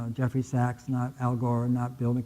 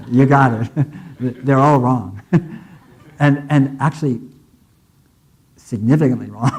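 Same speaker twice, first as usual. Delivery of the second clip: louder, laughing through the words; 7 dB louder than usual. Real, not acted. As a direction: laugh as you deliver the news. Laughing while giving the verdict.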